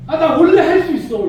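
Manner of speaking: loud, high, laughing boast; laughing confident boast. Shown as a demonstration. Preacher showing a cheerful self-belief, laughing loud. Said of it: "이러든지".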